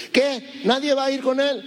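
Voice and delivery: high, outraged disbelief; a boy shocked that nobody will fight; indignant young David. Retold or imitated.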